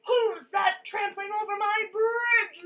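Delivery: loud, high troll roar. A narrator's reading. Troll challenging whoever crosses his bridge, roaring. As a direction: bellow it as an angry challenge. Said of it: "roared the troll".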